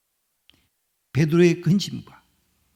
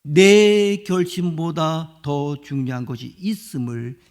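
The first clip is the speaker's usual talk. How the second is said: ordinary delivery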